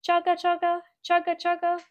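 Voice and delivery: high rhythmic chugga chugga; chugging train sound. Acted. Narrator imitating the train chugging along. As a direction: chant it like wheels on the track.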